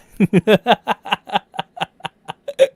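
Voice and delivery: long, high, loud laughing fit; real helpless cackle. Real, not acted. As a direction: burst out laughing at your own impression. Cracks up after crying impression.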